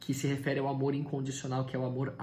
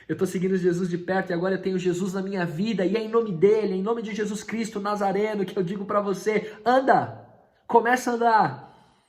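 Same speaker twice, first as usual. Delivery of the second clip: much louder, higher climactic command; preaching at full force; 7 semitones higher, 8 dB louder than usual. Acted. Pedro commands the lame man to walk, thundering. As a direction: thunder the command in Jesus' name.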